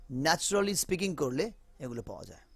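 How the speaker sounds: ordinary delivery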